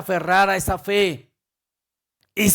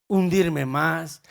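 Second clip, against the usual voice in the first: slow emphasis; much slower than usual. Acted. Repeats phrase slowly for weight.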